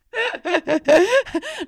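helpless high-pitched giggling; high giggle fit. Real, not acted. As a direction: laugh uncontrollably through a correction. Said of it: "It hurts to laugh".

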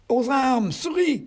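very high French rallying cry; rally the mice in a squeaky voice; mouse's call to arms. A narrator's reading. Mouse calls comrades to arms.

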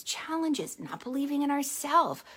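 ordinary delivery

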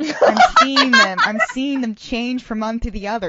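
laughing through a mock trance; dramatic seer, breaking up laughing; laughing mystic. Prophetess vision, laughing through.

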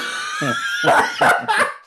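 shrieking laugh. Real, not acted. Cracking up mid line.

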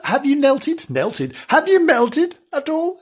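ordinary delivery

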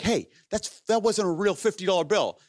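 high-pitched, indignant mock complaint; confronting the scalper. Menard accusing the scalper of a fake bill, mock indignant. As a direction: imitate a friend calling out a cheat.

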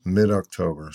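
normal talking voice

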